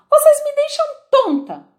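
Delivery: very high, loud yell; bellowing. A narrator's reading. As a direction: yell it, exasperated. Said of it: "berrou a rainha". (a rainha berrando, muito aguda e alta)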